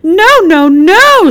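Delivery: high, loud repeated screech. Acted. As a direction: screech in horror. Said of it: "screeched Blanche".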